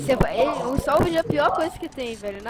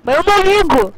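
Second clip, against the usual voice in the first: loud, higher, defiant; defiant shout; 6 semitones higher, 11 dB louder than usual. Real, not acted. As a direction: yell that you don't care. Defiant reply in a game argument.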